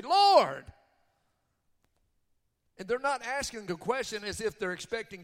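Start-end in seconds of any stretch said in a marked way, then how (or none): 0.0-0.7 high, very loud demanding cry; emphatic shout. Acted. Preacher acts out the many before Jesus, belligerent shout. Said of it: "Many in those days are gonna say".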